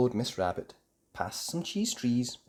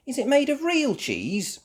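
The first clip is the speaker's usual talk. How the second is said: very high question; curious Peppa; 6 dB louder than usual. Acted. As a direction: ask hopefully. Peppa asks.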